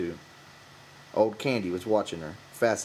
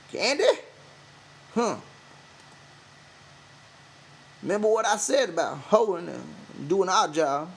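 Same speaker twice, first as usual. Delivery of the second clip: higher, louder call; calling after; 10 semitones higher, 4 dB louder than usual. A narrator's reading. Crooks calling Candy back at the door. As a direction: call him back before he leaves. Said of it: "Crooks called".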